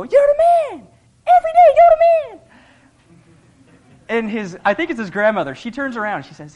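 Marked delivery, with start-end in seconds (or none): 0.0-2.4 put-on very high, loud little boy's cheer; excited little kid. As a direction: shout it like a delighted little kid at the window. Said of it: "this little high-pitched voice".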